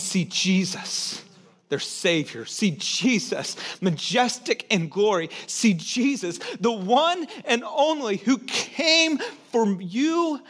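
slow, swelling repeated see Jesus; preaching at full force, slowly; impassioned preaching. Acted. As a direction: build it like a preacher, stretching each line. Preaching climax, repeated phrase.

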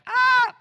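a sudden high yelp of someone dropping away; mock scream. Pastor imitating skydivers dropping off, high loud mock scream. People jumping from the plane.

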